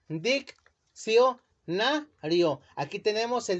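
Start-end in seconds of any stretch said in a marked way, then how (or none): 0.1-1.4 "Diccionario" split slowly as dic-cio-na-rio; "Diccionario" broken slowly into its syllables, dic-cio-na-rio; slow syllabified. Shown as a demonstration. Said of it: "¿Cómo se queda dividida?".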